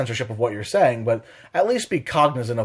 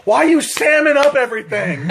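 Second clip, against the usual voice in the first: 1 mock baffled question; 12 semitones higher, 4 dB louder than usual. Mock line to distracting girl.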